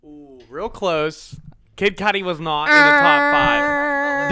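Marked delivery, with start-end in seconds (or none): 1.8-4.3 mock wail. Groans over a missed guess.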